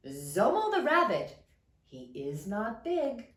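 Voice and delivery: plain reading voice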